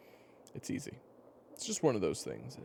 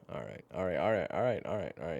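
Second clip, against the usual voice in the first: giving in; 5 semitones higher, 7 dB louder than usual. Acted. Gives in to the listener.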